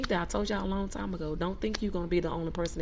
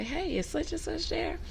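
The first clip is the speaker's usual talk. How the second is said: higher, slower polite greeting; courteous phone request; 9 semitones higher than usual. Shown as a demonstration. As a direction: ask politely with a lifted, unhurried voice. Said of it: "At least speak to that person".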